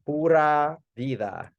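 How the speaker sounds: slow, drawn-out "Pura vida"; relaxed Costa Rican "Pura vida"; Central American phrase. Retold or imitated. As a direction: lean into "Pura vida" like a local. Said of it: "And he'll say".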